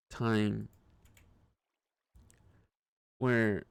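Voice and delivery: ordinary talk, nothing marked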